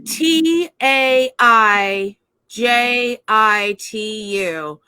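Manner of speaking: very slow, letter by letter; slow spelling. Real, not acted. Asked to say it again, spelling very slowly. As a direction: spell it out with long spaces between letters.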